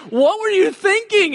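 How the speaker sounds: high, loud frantic scolding; a mother's shrill relief as rebuke; "what were you thinking" cried out high and loud. Retold or imitated.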